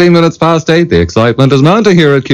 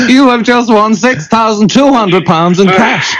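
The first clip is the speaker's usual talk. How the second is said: laughing; 8 semitones higher than usual. Real, not acted. Host announces the prize winner.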